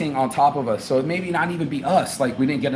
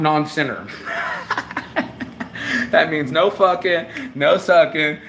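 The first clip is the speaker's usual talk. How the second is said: normal talking voice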